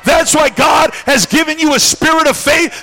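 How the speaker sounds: high, loud sermon shout; full-force preaching. Real, not acted. Preacher tells church God gave them faith, shouted preaching. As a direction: proclaim it forcefully to the room.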